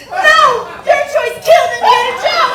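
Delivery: loud, mock-outraged yelling; angry shout. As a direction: berate him at full volume.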